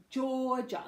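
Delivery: "Georgia" with a long "or" vowel; pronunciation demo. Shown as a demonstration. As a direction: say "Georgia" as jaw plus ja. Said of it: "So we've got or".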